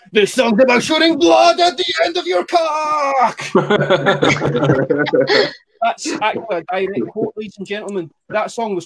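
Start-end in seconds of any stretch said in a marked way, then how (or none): 0.1-3.4 death metal growl. Retold or imitated. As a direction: bellow it like a metal frontman. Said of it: "introduced a song by saying".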